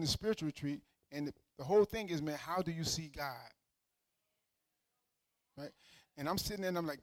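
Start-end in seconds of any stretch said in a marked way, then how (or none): none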